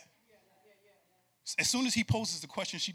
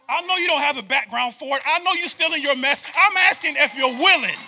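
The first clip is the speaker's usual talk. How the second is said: loud, high, ringing preacher call; hammering the repeated "if"; preaching at full force; 9 semitones higher, 12 dB louder than usual. Acted. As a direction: build the congregation up with fire.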